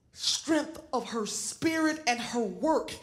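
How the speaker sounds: usual speaking voice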